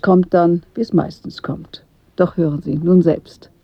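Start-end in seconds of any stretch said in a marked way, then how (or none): none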